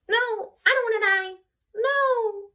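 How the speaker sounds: very high, whiny mock refusal; hammed-up panic; mock terror. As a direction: plead for your life in a squeaky high pitch. Acts out man refusing to die.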